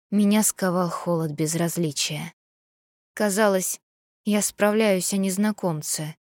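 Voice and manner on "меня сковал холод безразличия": ordinary delivery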